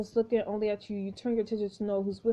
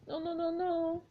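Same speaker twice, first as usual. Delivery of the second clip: panicked; 7 semitones higher, 4 dB quieter than usual. Acted. Lily panics.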